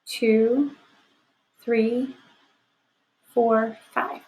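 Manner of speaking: slow, deliberate counting. Shown as a demonstration. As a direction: count the sentences off slowly. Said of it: "If you look over here".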